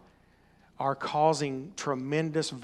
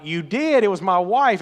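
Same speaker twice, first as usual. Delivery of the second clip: excited reply; 4 semitones higher, 7 dB louder than usual. Retold or imitated. Surprised seller recognizes him.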